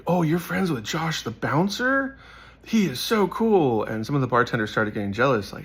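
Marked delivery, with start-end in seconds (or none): 0.1-3.8 high, slow, breathless fangirl gush; gushing admirer. Retold or imitated. Friend imitates girls raving about the bouncer, high gushing voice. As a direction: swoon over the bouncer in a girly voice.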